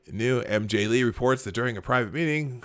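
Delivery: normal talking voice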